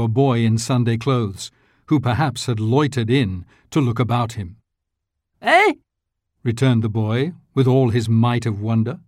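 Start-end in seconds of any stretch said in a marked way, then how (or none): 5.4-5.7 put-on surprised boy. A narrator's reading. Boy answers a shout.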